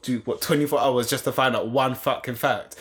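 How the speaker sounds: normal talking voice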